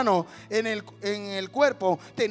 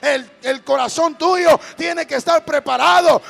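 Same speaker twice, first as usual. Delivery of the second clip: loud, fervent preacher's cry; full-force preaching; 3 semitones higher, 10 dB louder than usual. Acted. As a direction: preach it out to the whole crowd. (predicando a todo pulmón)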